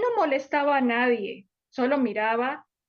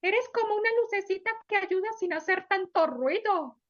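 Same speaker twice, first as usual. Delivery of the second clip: put-on higher, bright little girl voice; happy little girl; 7 semitones higher than usual. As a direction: voice a grateful small girl, pitched up. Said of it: "La niña feliz le dijo". (niña feliz con voz aguda)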